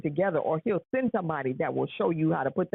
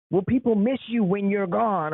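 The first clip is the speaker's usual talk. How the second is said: ordinary talk, nothing marked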